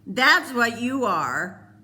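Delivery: slow, high, loud tearful insult; sobbing insult. A narrator's reading. Peter sobbing an insult at Cole, loud and slow. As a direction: spit it out through sobs. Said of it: "Peter sobbed".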